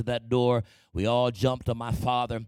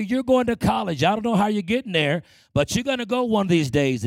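higher, louder determined mama; mother's insistence; 7 semitones higher, 5 dB louder than usual. Retold or imitated. Mother telling son he's going to college, higher, louder, firm. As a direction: insist like a mother who won't hear no. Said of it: "my mother would always say".

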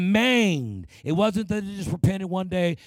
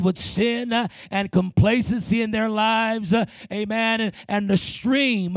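higher-pitched, driving sermon delivery; impassioned preaching; 4 semitones higher than usual. Real, not acted. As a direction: preach it in rolling phrases with punched endings.

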